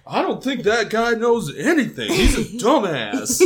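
put-on voice, loud and laughing; Hank Hill. Podcaster mocks Ed distrusting the city outsider, Hank Hill style. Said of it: "He's just really Hank Hilling it".

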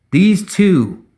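poised, projected declaration; high and clear. A narrator's reading. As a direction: present them to the whole crowd. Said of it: "rang out across the square, high and clear".

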